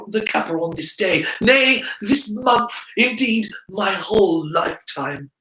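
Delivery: high, florid over-the-top flattery; Harry hamming up a fawning servant; mock grovelling. A narrator's reading. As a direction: lay the flattery on thick. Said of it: "Harry mock-grovels to Draco".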